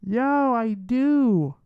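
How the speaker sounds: high, drawn-out exclamation; pleased discovery. Real, not acted. Streamer finding the wanted song on hand, pleased drawn-out exclamation. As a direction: stretch it out with delight.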